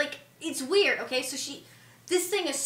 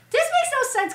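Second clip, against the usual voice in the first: much higher, louder protest; exasperated disbelief; 13 semitones higher, 5 dB louder, much faster than usual. Acted. Fan scolding Rey over the plot, exasperated disbelief.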